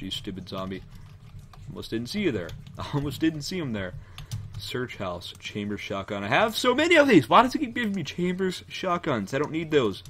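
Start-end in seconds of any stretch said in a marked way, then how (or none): 6.2-10.0 high, loud exasperated yelling; real frustration, shouted high. Real, not acted. Frustrated at repeated shotgun loot.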